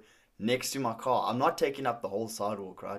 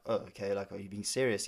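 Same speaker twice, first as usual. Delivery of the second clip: subdued realization; 4 semitones lower, 7 dB quieter than usual. Retold or imitated. Realizing the stranger is angry.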